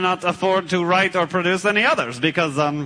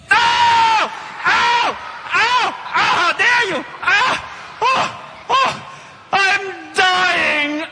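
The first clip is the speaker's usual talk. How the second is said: hammed-up dying cries; 11 semitones higher, much slower than usual. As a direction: overact a death scene. Hit again, dramatic.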